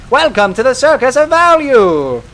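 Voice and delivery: loud, high, hammed-up shop jingle announcer; vending machine voice. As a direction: belt it out like a cheesy store machine. Mimicking the vending machine greeting.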